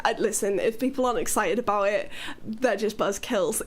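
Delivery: ordinary delivery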